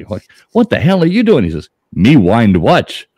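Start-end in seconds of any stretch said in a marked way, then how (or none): 0.1-1.4 much higher, louder cowboy; mock cowboy shout. Cowboy yelling at the whacking Indian, high and loud. 1.9-3.1 very slow, louder, stilted; mock Indian punchline. Joke teller delivering the punchline as the Indian, very slow.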